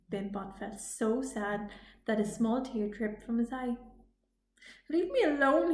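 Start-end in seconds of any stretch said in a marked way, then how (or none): 4.9-5.7 mild crying. Acted. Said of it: "he cried".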